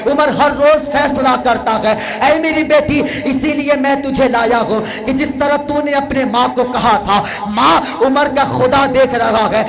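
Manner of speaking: fiery preaching voice calling out to the crowd; impassioned preaching. Acted. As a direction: build the sermon to its peak, pleading. Sermon climax, higher impassioned preaching.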